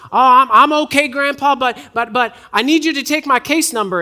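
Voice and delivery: put-on high, loud, stammering Southern accent; young southern caller. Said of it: "a younger voice with kind of a".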